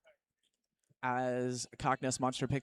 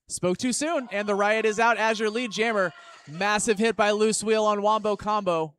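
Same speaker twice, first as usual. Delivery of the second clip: excited commentary; 5 semitones higher, 6 dB louder than usual. Real, not acted. Sudden big hits on the track.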